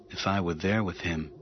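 quiet, to himself; thinking it, not saying it; inner thought. A narrator's reading. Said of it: "Anakin thought".

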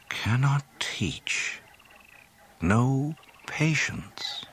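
slow, hushed repetition in thought; puzzled echo. A narrator's reading. Luke turns over Yoda's verdict, slow hushed echo. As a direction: murmur the words slowly as they sink in.

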